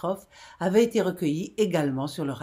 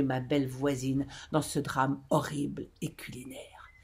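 whispered horror; 3 semitones lower, 6 dB quieter than usual. A narrator's reading. Horror of realization.

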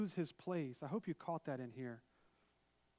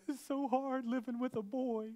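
high, loud, drawn-out mock wail; slow, high-pitched put-on crying voice; imitated crying wife; 8 semitones higher, 5 dB louder, slower than usual. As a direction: whine it tearfully, hammed up. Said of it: "she was crying and tears in her eyes".

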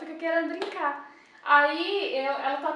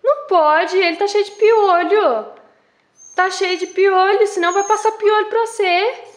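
gentle scolding to a dog; 5 semitones higher, 14 dB louder than usual. Real, not acted. Negando o passarinho à cachorra.

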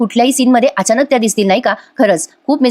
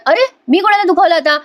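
indignant surprise; 9 semitones higher than usual. Acted. Husband protesting innocence.

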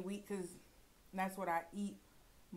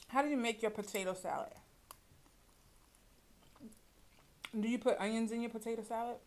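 chewing while talking; biting meat off bone; mouth full of pork. Real, not acted. Seen 0: Gloved hands bite meat bone while talking; mouth working on food.

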